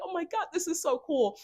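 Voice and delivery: high-pitched, overjoyed gush; excited. Retold or imitated. Dreamer marveling at the realm through tears, excited. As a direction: gush it, overwhelmed with joy. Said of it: "I just got so excited".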